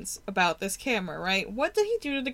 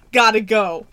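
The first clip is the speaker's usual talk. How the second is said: loud urgent quote; 2 semitones lower, 8 dB louder than usual. Retold or imitated. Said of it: "and is like".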